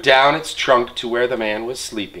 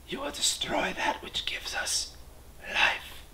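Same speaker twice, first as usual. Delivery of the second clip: softer breathy whisper; whispering porcupines; 2 semitones higher, 13 dB quieter than usual. Acted. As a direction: whisper it close to his ear. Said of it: "and whispered to the man".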